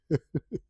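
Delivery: brief, soft chuckle; a guilty little laugh. Real, not acted. Reviewer amused by the boy on the road, soft laugh.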